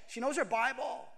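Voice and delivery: ordinary talk, nothing marked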